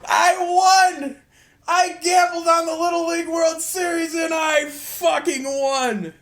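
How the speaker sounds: high, loud victory cry; ecstatic shouting. Real, not acted. Gambler who just won his Little League bet, ecstatic shouting. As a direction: crow at the top of your voice about winning.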